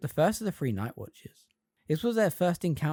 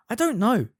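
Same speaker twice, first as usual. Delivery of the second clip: frustrated; 9 semitones higher, 7 dB louder than usual. A narrator's reading. Harry ashamed, unsure.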